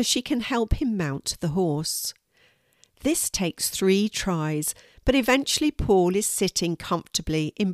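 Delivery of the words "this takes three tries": ordinary delivery, nothing marked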